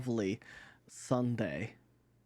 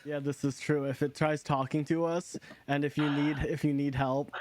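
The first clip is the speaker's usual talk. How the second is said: ordinary talk, nothing marked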